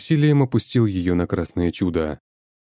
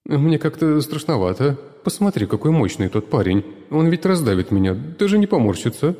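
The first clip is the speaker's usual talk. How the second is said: put-on higher scared tomato girl; tomato girl afraid of the big guy; timid tomato; 9 semitones higher than usual. A narrator's reading. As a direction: fret in fear of the brute. Frightened tomato.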